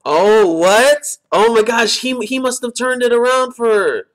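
high-pitched, stunned outburst; astonished reaction. Real, not acted. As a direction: react in real disbelief, voice jumping up high. Stunned by a plot twist.

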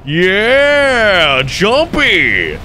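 high, drawn-out whoop of praise; excited cheer. Real, not acted. Player cheering on Chompy the fish, excited high cheer.

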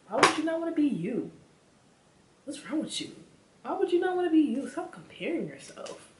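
high, loud, incredulous questioning; exasperated pep talk. Acted. Vlogger scolds viewers for comparing themselves, high exasperated disbelief.

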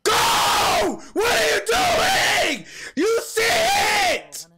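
very high, drawn-out full scream; frantic screaming. Real, not acted. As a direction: scream in desperation at the screen.

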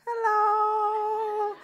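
mock-innocent hello; awkward hello. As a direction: chirp it to dodge the awkwardness. Awkward greeting to avoid moment.

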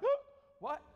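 playful gasp of shock; mock shocked gasp. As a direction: imitate a crowd's gasp. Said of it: "there was a gasp in the crowd".

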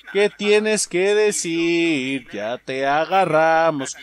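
very slow, stretched-out gloating; slow, loud drawn-out taunt. Pretend. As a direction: drag every word out at the caught smuggler. Repeats question slowly, taunting caught smuggler.

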